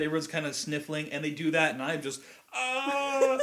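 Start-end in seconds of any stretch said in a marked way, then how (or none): 2.5-3.4 high, drawn-out mock sob; hammed-up burst into tears; mock wail. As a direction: wail like the tears just broke loose. Imitates breaking down crying.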